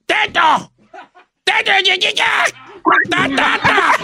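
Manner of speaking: botched intro yelled as a joke; silly shout. Acted. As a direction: yell nonsense syllables like a clown. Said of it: "Dilo bien, Chino".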